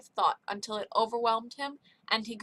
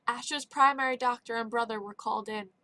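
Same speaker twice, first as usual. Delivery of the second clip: plain reading voice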